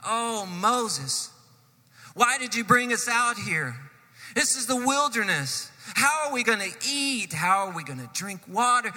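high, whiny complaining crowd voice; grumbling and complaining. Acted. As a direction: moan at Moses like the restless people in the wilderness. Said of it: "start grumbling and complaining".

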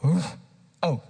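ordinary talk

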